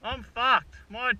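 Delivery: frustrated. Real, not acted. Stranded with broken bike.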